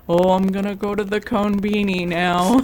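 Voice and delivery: lightly put-on sloth voice; imitating an anime sloth; cartoon sloth. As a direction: do a silly sloth character voice. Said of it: "the sloth was like".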